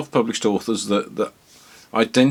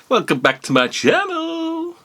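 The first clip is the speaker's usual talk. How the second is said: very high, sing-song YouTuber welcome; chirpy vlogger intro; bubbly. Pretend. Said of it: "I'm too old for this game".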